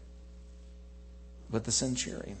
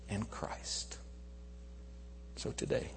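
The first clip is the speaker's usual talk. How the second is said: hushed; 2 semitones lower, 9 dB quieter than usual. Acted. Hushed after climax.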